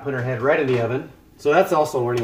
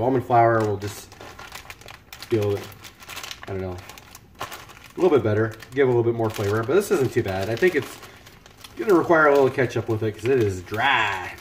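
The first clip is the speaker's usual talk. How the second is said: ordinary delivery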